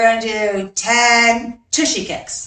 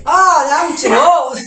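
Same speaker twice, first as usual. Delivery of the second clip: laughing complaint, a little louder; laughing at herself; 5 semitones higher, 6 dB louder, slower than usual. Real, not acted. Coach laughing at herself during squat jumps. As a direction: chuckle out a groan of effort mid-jump.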